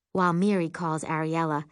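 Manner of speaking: ordinary delivery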